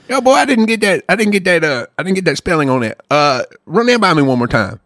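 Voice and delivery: put-on Southern good old boy asking back; mock Southern accent; put-on country accent. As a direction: play a puzzled customer in country twang. Plays customer to Old Man Gaffney.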